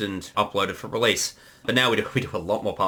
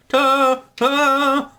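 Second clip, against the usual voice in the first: imitating Metal Edamon's quiver on a drawn-out cry; high, loud mock quiver; quivering character voice; 6 dB louder than usual. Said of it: "quiver in Metal Edamon's voice".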